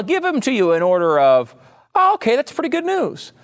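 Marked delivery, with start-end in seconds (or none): 1.9-3.3 very high-pitched, light quoted voice; mock relieved reaction. Host voicing a Trump fan's reaction to the states, high-pitched. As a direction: pitch it way up, pleasantly reassured.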